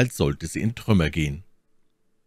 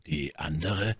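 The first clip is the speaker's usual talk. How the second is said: soft, hushed question; subdued; 3 semitones lower, 8 dB quieter than usual. A narrator's reading. Shaken friend asks about the dead woman, subdued. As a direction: drop to a barely audible murmur. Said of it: "erwiderte Athos mit so gedämpfter Stimme".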